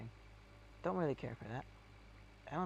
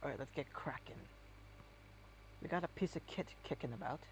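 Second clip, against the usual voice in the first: rural English; 6 dB quieter, faster than usual. Retold or imitated. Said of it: "the virtual farmer English".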